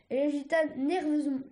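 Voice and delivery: plain reading voice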